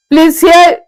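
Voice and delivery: ordinary talk, nothing marked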